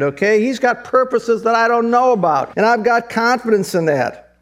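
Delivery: high, ringing preacher's cry; fervent sermon peak; impassioned preaching. Acted. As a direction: lift it up and preach it hard. Preaching climax on God's purposes.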